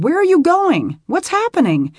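high, loud frightened questions; panicked and confused. Acted. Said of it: "She sounded frightened again".

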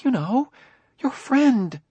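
high-pitched, anxious hint; wife broaching a touchy subject; worried wife. Acted. Worried wife hinting to husband.

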